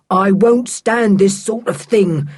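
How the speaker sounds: slow, stiff-voiced rage; raging. A narrator's reading. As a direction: spit out his outrage. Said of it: "he raged".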